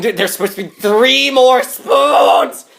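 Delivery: building to a high, drawn-out yell; hammed-up distraught high shout; frantic outburst. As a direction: wail it loudly, stretching the last words. Spoon dude frets over missing spoons.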